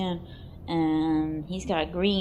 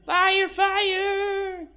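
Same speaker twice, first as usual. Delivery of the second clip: high, loud, held-out shout of fire; mock alarm cry; 13 semitones higher, 6 dB louder than usual. Narrator as the angel sets it on fire, mock alarm cry. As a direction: raise a hammed-up alarm, pitched way up. Crying out as the sheep burns.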